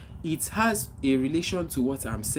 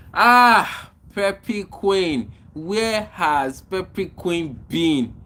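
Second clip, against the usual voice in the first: loud, high "Ah!", then drawn-out slow welcome; real delight at spotting a returning friend; excited greeting; 5 semitones higher, 7 dB louder, much slower than usual. Real, not acted. Host hails a viewer who has been away, excited greeting.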